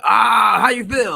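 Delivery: loud, high gloating jeer; mock taunting fan. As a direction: rub it in like a rival fan after a touchdown. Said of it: "they're like".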